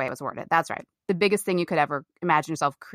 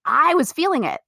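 ordinary talk, nothing marked

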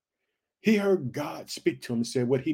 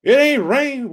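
a touch of a jeering neighbour, higher and louder; mocking neighbour; 6 semitones higher, 7 dB louder, slower than usual. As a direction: taunt Noah lightly. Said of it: "people walking up to you year after year saying".

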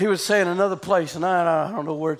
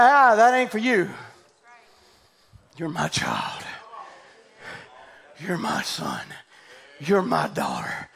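tender voice of God; 4 semitones lower, slower than usual. Acted. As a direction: murmur it low like a loving father. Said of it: "It's like".

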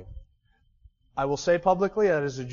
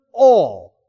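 "all" said much louder; stress on all; 10 dB louder than usual. Shown as a demonstration. Preacher singles out the word "all", loud stress. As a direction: punch "all" hard. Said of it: "It's that word".

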